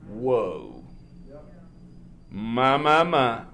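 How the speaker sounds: low, hushed whoa, my my my; awed whoa. Real, not acted. Preacher marvelling to the church, low and hushed. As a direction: breathe it out low in awe.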